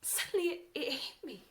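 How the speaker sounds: very loud, high-pitched revelation; heated, high and forceful; agitated outburst. Acted. Carrying on over his interruption.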